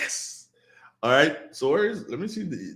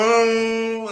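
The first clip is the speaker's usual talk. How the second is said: high, loud imitated child's cry; mock child's wail; 10 semitones higher, 5 dB louder than usual. Host imitating a hungry child's wail.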